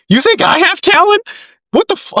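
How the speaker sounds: high, amazed questioning; high-pitched stunned reaction; stunned disbelief. Retold or imitated. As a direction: squeak it out in astonishment. Retold disbelief at praise.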